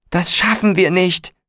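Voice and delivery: high-pitched cry of despair, only mildly shrieked; panicked shriek. A narrator's reading. Corinna as the fire spreads, high panicked cry. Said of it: "kreischte Corinna Thiemann".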